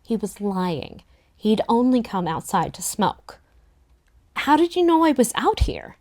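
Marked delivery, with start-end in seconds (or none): 4.3-6.0 higher, insistent demand. A narrator's reading. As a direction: press him for an answer. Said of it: "I demanded".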